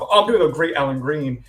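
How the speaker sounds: usual speaking voice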